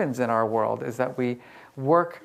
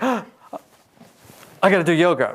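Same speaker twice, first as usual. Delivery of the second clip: mock panic; 5 semitones higher, 6 dB louder than usual. Mock panic at own stress.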